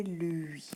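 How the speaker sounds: "lui" drawn out as lll-ui; isolated syllable. Shown as a demonstration. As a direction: sound out "lui" slowly on its own. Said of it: "la deuxième syllabe est".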